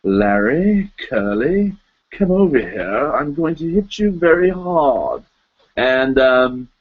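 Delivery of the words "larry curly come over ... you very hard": lightly voiced suave menace, rising; a hint of a polished film star bossing the Stooges; mild mock-suave threat; James Mason as a Stooge. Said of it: "James Mason as a member of the Three Stooges".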